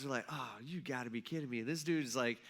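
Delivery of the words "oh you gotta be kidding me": low, muttered disbelief; eye-rolling exasperation. Retold or imitated. Annoyed fishermen reacting to a stranger, exasperated mutter. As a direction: grumble it under your breath with an eye roll. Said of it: "rolling their eyes".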